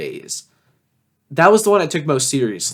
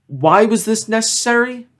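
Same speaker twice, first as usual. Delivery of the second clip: exasperated; 9 semitones higher than usual. Real, not acted. Complaining about his old song.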